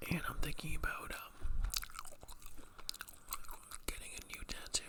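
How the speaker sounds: soft ASMR whisper; breathy whisper to the mic. Real, not acted. Whispers all through.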